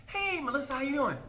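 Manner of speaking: mock sweet greeting. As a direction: greet her in a high, eager voice. Mimicking guys greeting moody Melissa.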